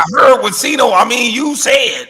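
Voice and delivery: high, loud mock call-out; hammed-up shouting impression of a critic; imitating a rival. Co-host predicting a rival's reply about him, mock-indignant shout. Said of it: "He gonna come up with something about me".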